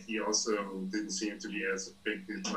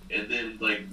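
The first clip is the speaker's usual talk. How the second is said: ordinary delivery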